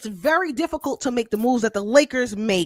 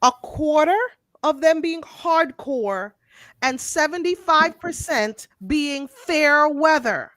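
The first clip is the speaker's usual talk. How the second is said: measured phrases with long pauses; slower, deliberate explaining; 3 semitones higher than usual. Real, not acted. Host explaining the fan market to the guys, slow and deliberate. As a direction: spell it out piece by piece, emphatically. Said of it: "I'ma say it slower".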